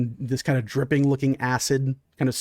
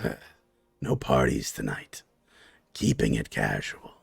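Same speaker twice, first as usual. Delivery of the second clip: much lower, scolding and commanding; low softer reprimand; deep stern monster boss; 5 dB quieter than usual. Acted. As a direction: talk down to the brute from deep in the chest. Leader reins him in.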